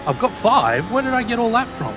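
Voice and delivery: high, fast disbelief; can't believe the penalty points; surprised. Real, not acted.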